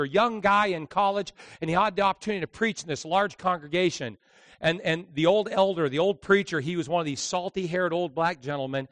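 ordinary delivery

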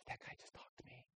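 hushed whisper to her friends; whispered aside. Retold or imitated. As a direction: whisper it behind your hand.

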